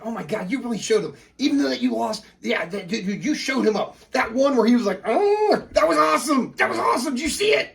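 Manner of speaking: high, loud gushing hype; breathless fan-style excitement, climbing high; excited spazzing. Acted. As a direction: gush like a thrilled sidekick replaying the big moment. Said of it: "he's spazzing over it".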